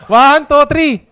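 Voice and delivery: counting off loudly for the crowd; loud countdown for the group to read. Real, not acted. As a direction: call it out, cueing the room. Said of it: "Basahin nyo nga".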